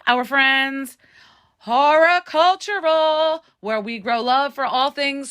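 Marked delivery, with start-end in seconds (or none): 1.6-3.4 loud, high, drawn-out title call; show title announcement. Acted. Host opening the podcast for listeners, drawn-out announcer call. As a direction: announce it big and slow.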